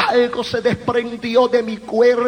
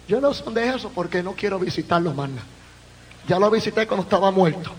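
quick aside; 6 semitones lower, 4 dB quieter, much faster than usual. Real, not acted. Quick aside about the morgue.

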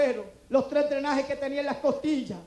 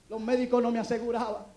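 usual speaking voice

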